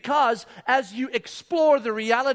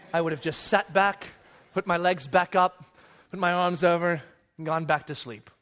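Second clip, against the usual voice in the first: ordinary delivery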